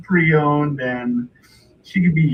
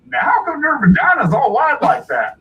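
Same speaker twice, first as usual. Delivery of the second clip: high, loud, swooping complaint; indignant hillbilly buyer; 10 semitones higher, 6 dB louder than usual. Retold or imitated. Buyer inspecting the doll objects, indignant hillbilly. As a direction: holler it like an appalled rube. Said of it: "and he's like".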